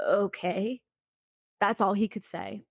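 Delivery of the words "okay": a retold, taken-aback okay; mock a speechless reply; stunned okay. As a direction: mildly imitate a dumbfounded man. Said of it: "his jaw was literally on the floor".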